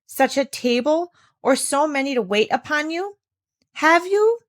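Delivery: outraged lecture, peaking high on the last question; indignant scolding. Acted. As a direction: shame her for wanting to leave. Governess berates ungrateful child.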